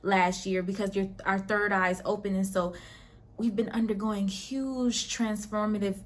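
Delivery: usual speaking voice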